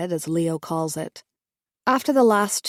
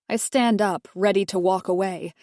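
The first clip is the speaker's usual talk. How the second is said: plain reading voice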